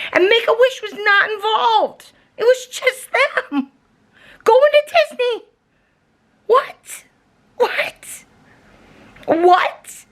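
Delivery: high, tearful, exasperated whine; half-crying disbelief. Real, not acted. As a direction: voice cracking up in outrage. Outraged disbelief at the scam.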